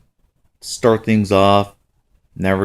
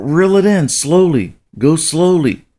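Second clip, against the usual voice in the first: higher-pitched urging; eager dad's retold instructions; excited coaching; 7 semitones higher than usual. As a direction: coach her through the catch with urgency. Father coaches daughter reeling in.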